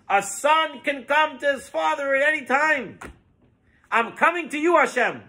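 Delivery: high-pitched, impassioned appeal to heaven; fervent plea. Acted. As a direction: plead your case boldly before God. Rabbi's fervent plea to God, acted.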